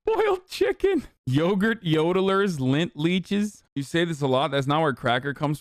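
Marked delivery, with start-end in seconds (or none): 0.1-1.0 high laughing read. Real, not acted. Streamer reading a silly slur list, laughing. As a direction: crack up mid-word, way up high.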